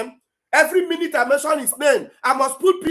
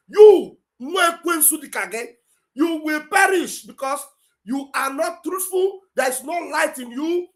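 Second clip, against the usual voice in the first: angry denunciation; 4 semitones higher, slower than usual. Real, not acted. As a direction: pronounce doom on an accuser, in real anger. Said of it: "This guy just make me angry now".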